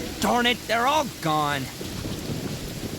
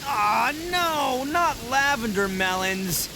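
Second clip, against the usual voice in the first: high groan sinking in disappointment; dismayed; 5 semitones higher, slower than usual. Acted. Expert finding melons instead of beetles, dismayed groan. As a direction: whine it out, let down.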